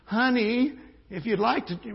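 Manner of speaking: high, slow, sugary mock-pleading; high, slow, over-sweet plea; timid pleading parent. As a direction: mimic a parent who asks too nicely. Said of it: "is not".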